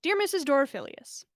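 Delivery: plain reading voice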